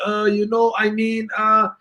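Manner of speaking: put-on high, halting Hebrew-accented hesitation. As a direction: fumble for words with a Hebrew accent. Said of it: "And they're breaking English".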